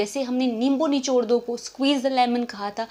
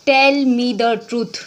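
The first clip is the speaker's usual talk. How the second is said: drawn-out, louder model line; slow and loud; 5 dB louder, much slower than usual. Shown as a demonstration. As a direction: say it slowly for the class. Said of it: "कभी ये statement बोलना हो तो क्या बोलोगे?". (धीरे और ज़ोर से)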